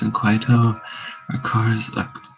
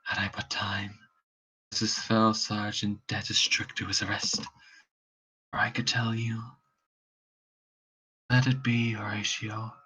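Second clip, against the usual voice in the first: faint dying voice; 11 semitones lower than usual. Acted. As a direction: let the life drain from the voice. Hamlet dying, fading voice.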